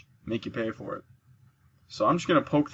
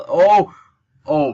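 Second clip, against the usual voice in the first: surprised; 4 semitones higher, 11 dB louder than usual. Real, not acted. Draven nearly dies to traps.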